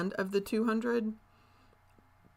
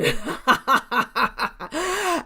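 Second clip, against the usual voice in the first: high, loud burst of real laughter; delighted belly laugh; 9 semitones higher, 10 dB louder than usual. Real, not acted. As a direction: crack up at the little fight. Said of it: "Sorry, I'm laughing".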